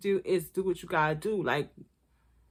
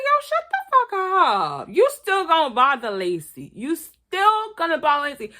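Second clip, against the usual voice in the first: mock angry shout; 7 semitones higher, 4 dB louder than usual. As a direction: snap it like a fed-up dad. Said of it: "It's like".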